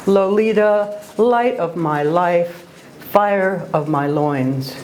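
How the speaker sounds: slow, lingering recitation of "Lolita, light of my life"; slow lyrical reading, long vowels; slow poetic reading. Shown as a demonstration. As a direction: savour the vowels, slowly. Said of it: "the first sentence of Lolita".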